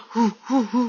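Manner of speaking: short breathy gorilla hoots; gorilla hooting. Retold or imitated. Teacher shows Jack hooting like a gorilla, imitated hoots. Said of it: "후팅하는 소리를".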